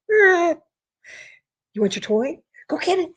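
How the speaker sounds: high, teasing dog-owner voice; high and drawn-out, egging the dog on; playful pet talk. Real, not acted. Owner teasing the dog with his toy, high and playful.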